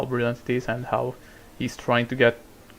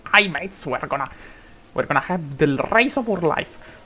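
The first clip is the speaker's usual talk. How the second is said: Scottish accent impression; 3 semitones higher than usual. Commentator mocking a Scottish accent for the chat, lightly put on. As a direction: ham up a Scottish commentator's cheer. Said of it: "then you got Scottish, which is like".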